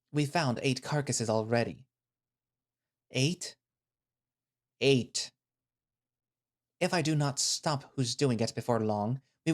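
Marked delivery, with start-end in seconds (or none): 3.1-3.5 Eight? rising in shocked disbelief; disbelieving question. Acted. Narrator hearing the carcass count, a stunned rising question. 4.8-5.3 Eight. said flat and final with a falling tune; grim confirmation. Acted. The stern man confirming the carcass count, flat and grim.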